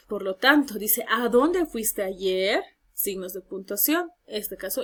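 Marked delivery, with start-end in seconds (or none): 1.1-2.6 slow, clear rising question tune; question read aloud. Shown as a demonstration. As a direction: read it slowly as a model question. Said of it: "Estos signos van a ser preguntas explícitas y directas".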